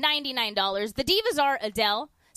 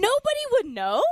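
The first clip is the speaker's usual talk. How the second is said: high, loud, gleeful; excited; 10 semitones higher, 4 dB louder than usual. Real, not acted. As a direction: say it like a delighted secret. Excited joke about impostor.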